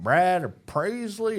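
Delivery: slow, high drawled Southern twang; deep south accent. Retold or imitated. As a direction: mimic a thick Texas drawl. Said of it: "why do you go deep south when you say that".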